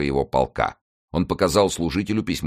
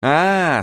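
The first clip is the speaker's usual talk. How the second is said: high, loud "ah" of relief; relieved sigh; 12 semitones higher, 6 dB louder than usual. A narrator's reading. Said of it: "с облегчением вздыхая". (вздох облегчения, высоко и громко)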